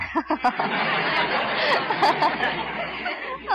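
big burst of high laughter. Acted. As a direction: laugh loud and high at your own joke. Laughs at own joke.

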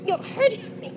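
high, strangled pleading; strangled cries climbing to a scream; terrified, being choked. Acted. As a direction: gasp it out in panic, rising to a scream. Wife being choked by husband.

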